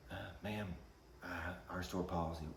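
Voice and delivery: almost whisper, appeasing; timid manager. Retold or imitated. Manager nervously reasoning with the angry mother, quiet. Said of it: "the manager's like".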